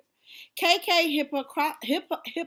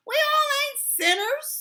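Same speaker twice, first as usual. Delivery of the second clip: mock protest; 11 semitones higher, 6 dB louder than usual.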